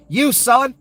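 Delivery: high, loud shout. Real, not acted. Host yells at the viewer who is losing care, loud and high. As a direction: jab the words straight at the listener.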